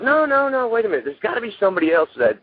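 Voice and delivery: high, fast pleading voice as his wife; imitating his wife's high-pitched "no, no, no"; wife protesting. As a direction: jump way up to play the wife cutting him off. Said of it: "And my wife goes".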